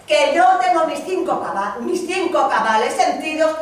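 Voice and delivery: loud, furious refusal; angry wife. Acted. Wife scorning her husband, loud and furious. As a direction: she lashes out at her husband.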